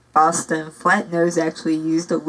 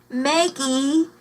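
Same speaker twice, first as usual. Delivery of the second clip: put-on higher child's voice calling a name; child calling back; 8 semitones higher than usual. Child outside the door calls Maggie, high child's call. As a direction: call her name like a small boy outside. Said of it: "a child's voice called back".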